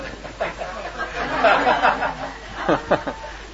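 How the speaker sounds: a big warm laugh at the memory; hearty laugh. Real, not acted. Laughing at his own Last Supper memory, a hearty laugh. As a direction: let the laugh roll out high and long.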